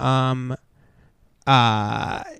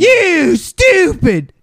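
high, slow, dim-witted jeer; hammed-up dumb ballplayer insult; mock caveman voice; 12 semitones higher, 3 dB louder, much slower than usual. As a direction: drawl it like a dumb brute. Mock dumb batter retort.

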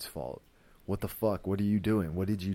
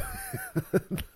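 high-pitched real laugh; amused laugh. Real, not acted. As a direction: burst out laughing at a wild story. Laughs at the wild story.

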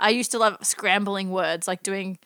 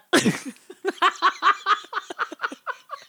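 laughing; 9 semitones higher than usual. Real, not acted. Laughs at own joke.

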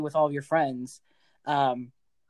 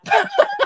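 laugh; 5 dB louder than usual. Real, not acted. Laughing at wedding story.

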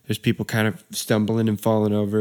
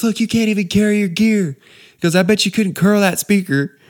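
taunting groom voice; 10 semitones higher, 4 dB louder than usual. Retold or imitated.

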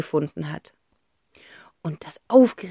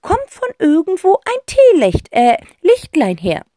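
high, loud aunt voice reciting a saying; Tante Rotz quoting; 11 semitones higher, 7 dB louder than usual. A narrator's reading. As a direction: quote the old song like a grand eccentric aunt. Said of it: "zitiert Tante Rotz".

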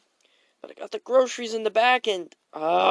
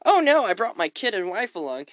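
ordinary talk, nothing marked

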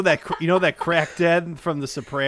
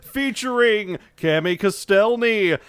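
slow, loud, higher announcer; 6 semitones higher, 6 dB louder, much slower than usual. Pretend. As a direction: boom it like a show intro. Mock announcer intro.

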